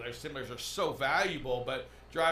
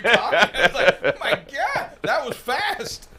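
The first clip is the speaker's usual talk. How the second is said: laughing; 9 semitones higher, 11 dB louder than usual. Real, not acted. Laughing at the speed.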